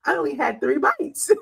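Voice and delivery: laughing. Real, not acted. Laughing at daughter's speed.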